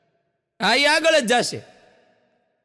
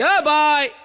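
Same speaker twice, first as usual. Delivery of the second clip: calling out loud; 6 semitones higher, 4 dB louder, much slower than usual. Retold or imitated. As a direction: shout across the street, higher and louder. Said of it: "માણાને રાડ પાડી". (ઊંચા અવાજે બૂમ)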